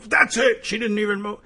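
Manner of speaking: lightly acted, high-pitched exasperation; mock exasperated gunman. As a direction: pitch it up as if fed up. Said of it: "and you go".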